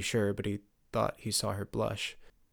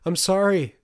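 high, loud, desperate apology; mother almost shouting her apology to her son; 9 semitones higher, 10 dB louder than usual. Acted. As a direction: cry out sorry, close to a shout. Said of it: "Sophia was almost shouting now".

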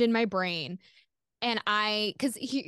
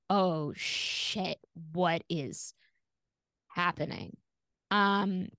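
lower, quieter, sinking dread; 4 semitones lower, 3 dB quieter, much slower than usual. Retold or imitated. Caller sensing something wrong on the line, quiet dread. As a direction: let it drain out of you, quiet and uneasy. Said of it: "And it was just like".

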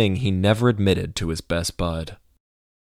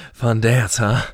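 breathy huff, then the words; lightly winded from the dancing; huffed, out of breath; 3 semitones higher, slower than usual. A narrator's reading. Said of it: "Joey huffed, slightly out of breath".

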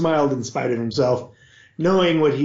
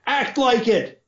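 shout; 10 semitones higher, slower than usual. A narrator's reading. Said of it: "Frederane shouted at the boy".

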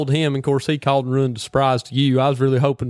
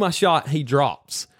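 usual speaking voice

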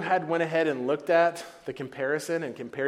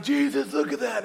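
higher, eager call to Jesus; excited nudge; 10 semitones higher, 3 dB louder than usual. Retold or imitated. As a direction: point it out like giddy kids. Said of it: "They're like".

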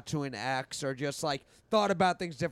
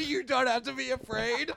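higher-pitched, through laughter; laughing mock reassurance; 7 semitones higher than usual. Host imagining texting a random number, laughing. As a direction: giggle out the pretend reassurance.

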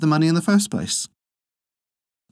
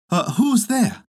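put-on much higher, startled old woman; old wife's voice; 12 semitones higher, slower than usual. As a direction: answer the knock like a fussy old wife. Old wife answers the door.

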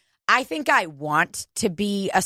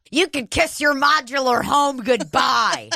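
mock shout; 6 semitones higher than usual. Mock server threat.

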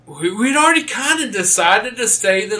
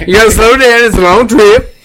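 laughing louder; 4 semitones higher, 8 dB louder than usual. Real, not acted. Laughing at hogging mic.

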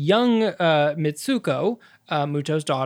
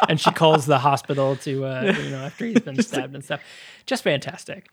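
normal talking voice

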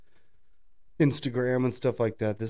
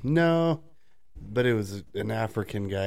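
ordinary talk, nothing marked

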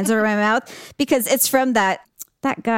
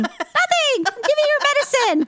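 high, sweet fake innocence; innocent little kid voice. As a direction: play the innocent child caught out. Sister's innocent excuse, retold.

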